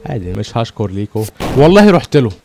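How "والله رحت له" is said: raised, higher indignant cry; loud protest. Real, not acted. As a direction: insist at the screen. Protesting the game's unfairness. (احتجاج بصوت عالي)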